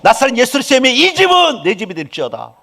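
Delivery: preacher demonstrating a bold prayer; bold declaration prayer. Shown as a demonstration. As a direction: claim the house out loud in faith. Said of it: "기도해".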